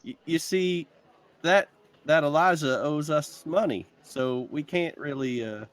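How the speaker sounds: high put-on Southern drawl, slow; a hammed-up Southern plea about money; southern accent. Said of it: "I gotta make it southern".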